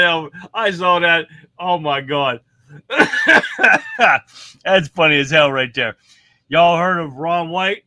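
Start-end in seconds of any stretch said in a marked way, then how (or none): 2.7-4.6 high burst of laughter. Real, not acted. Host laughing at clip.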